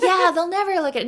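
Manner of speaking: laughing. Real, not acted. Both laughing at brands ignoring kits.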